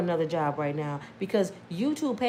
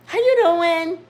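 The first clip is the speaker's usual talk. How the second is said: loud, high sing-song hello; fake cheerful greeting; 7 semitones higher, 8 dB louder than usual. Greeting the supervisor with a faked cheerful hello. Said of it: "fake it".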